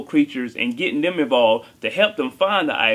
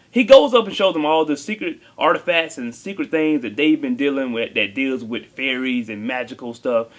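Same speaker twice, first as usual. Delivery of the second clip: usual speaking voice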